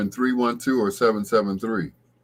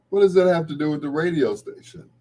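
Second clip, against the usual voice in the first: mock puzzled; 7 semitones higher, 3 dB louder, faster than usual. Mock-puzzled caller voice again.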